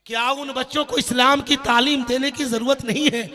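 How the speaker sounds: driving, insistent sermon oratory; forceful preaching. Real, not acted. Preacher challenging critics of madrasa schooling, forceful preaching. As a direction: hammer each repeated challenge at the congregation. Preacher exhorts Muslims with repeated questions.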